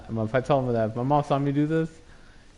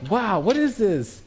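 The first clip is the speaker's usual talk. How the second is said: amazed wow; 9 semitones higher, slower than usual. Retold or imitated. First seeing manga as a kid.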